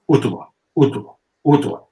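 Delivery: "utua" said as "oo-tuh-uh", with the a like a soft o; "utua" repeated with a rounded oo and a soft o for the a; Mudger pronunciation. Shown as a demonstration. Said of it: "A in Mudger sounds more like a soft O".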